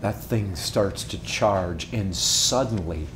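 low, soft, drawn-in preaching voice; softly telling the arena scene to the congregation; hushed storytelling. Acted. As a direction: pull them in close with a low, soft voice.

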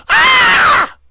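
put-on very high, loud shriek of a scared kid; imitated scream. Burglar caught by the foot, imitated high scream. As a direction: shriek like a twelve-year-old. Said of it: "he screamed like a twelve-year-old".

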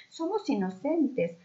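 high, slow overacted plea; hammed-up child victim; mock pleading cry. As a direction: wail your innocence theatrically. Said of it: "volvió a gritar Camila".

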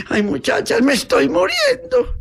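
hammed-up deathbed cry; dying wail. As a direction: wail like a stricken patient. Sick woman wails that she is dying.

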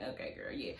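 breathy whisper, almost no voice; whispered aside. Retold or imitated. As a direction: mutter it under your breath. Her reaction to Malaysia.

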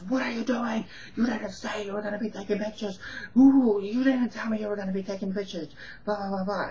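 mock the tenant losing it over the camera; flipping out. Tenant flipping his lid at the photographer, outraged high-pitched squeal. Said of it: "the guy just flips his lid".

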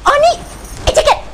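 exasperated yell. Acted. Grandma yells at the cat.

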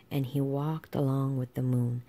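plain reading voice

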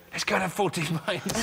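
eager invitation to a mate; mock suggestion to mate. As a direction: pitch it up like asking your best mate out.

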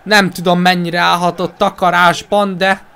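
ordinary talk, nothing marked

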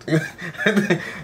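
short real laugh at his own joke; a genuine burst of laughter. Real, not acted.

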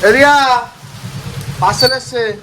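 loud call to someone outside. Real, not acted. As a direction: shout across the house. Calling out about parcel.

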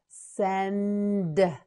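"Send" with long n, separate d; slow modelling. Shown as a demonstration. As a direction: hold the n in "Send". Teacher models word slowly.

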